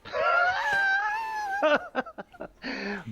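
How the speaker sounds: high, loud shrieking laugh; long peal of piercing laughter. Acted. Lady Saveshna meets the newcomer, high shrieking laugh.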